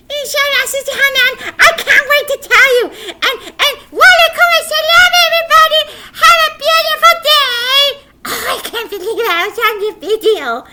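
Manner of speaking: very high, loud puppet squeal; fast giddy goodbye; excited puppet. Acted. Puppet boy says goodbye to the viewers, squealing.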